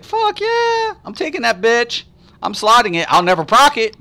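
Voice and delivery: shrieked high burst of glee; mock excitement. Streamer retelling grabbing the fifteen percent, high mock glee. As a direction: whoop it like a greedy kid. His own quoted excitement.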